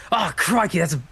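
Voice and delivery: put-on over-the-top Aussie accent; hammed-up Australian accent. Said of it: "I can't do an Aussie accent".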